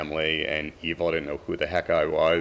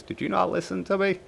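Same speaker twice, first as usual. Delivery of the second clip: mock scolding; 3 dB louder than usual. Magic scolding him fondly.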